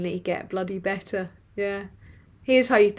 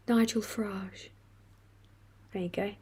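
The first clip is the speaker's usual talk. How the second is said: softer, whispered impression; Princess Diana; 9 dB quieter than usual. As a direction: whisper it as a demure royal. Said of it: "Say something filthy as Diana".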